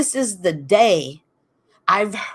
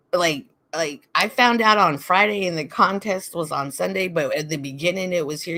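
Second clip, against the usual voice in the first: usual speaking voice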